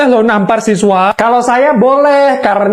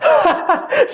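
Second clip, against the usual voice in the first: short laugh that jumps very high; 3 dB quieter than usual. Real, not acted. Narrator laughing at his own neighbour joke, high giggle.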